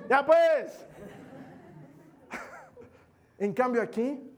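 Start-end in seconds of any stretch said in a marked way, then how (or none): 0.1-0.9 high and loud with a laugh; laughing mock scold. Parent telling shower-singing child to stop, laughing. 2.3-2.8 high laugh. Real, not acted. Laugh after the joke.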